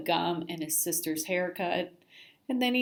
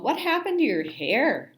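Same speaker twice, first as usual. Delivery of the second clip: mild yell; 5 semitones higher, 5 dB louder than usual. A narrator's reading. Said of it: "Joey yelled".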